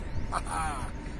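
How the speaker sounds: low, soft mock chuckle; scoffing laugh. Acting out the poem's scoffer, mocking laugh. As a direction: scoff with a short falling laugh.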